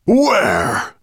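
high, loud, drawn-out roar; booming demand. A narrator's reading. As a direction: thunder the question. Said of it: "His voice boomed through the ancient vaults".

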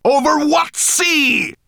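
high, loud, drawn-out shout; angry shout. A narrator's reading. Nettlebrand demanding an answer from the raven, shouted. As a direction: shout it slowly in exasperation. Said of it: "Nettlebrand shouted at him".

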